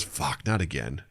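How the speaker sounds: low, slow, defeated mutter. Retold or imitated. To the Dunkin' staff, low weary mutter. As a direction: sigh the words out low. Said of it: "And he goes".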